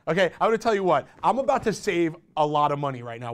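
ordinary talk

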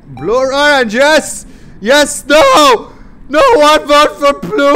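loud, high excited yelling; excited shouting. Real, not acted. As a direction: shout it out, thrilled. Excited shouting during vote.

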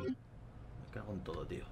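cursing under breath. Real, not acted. Frustrated at missing ammo.

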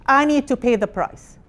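ordinary delivery

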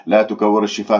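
ordinary talk, nothing marked

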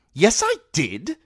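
put-on a spoilt child digging in his heels; insistent boy king. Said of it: "Joffrey insisted".

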